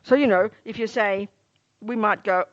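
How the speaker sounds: ordinary delivery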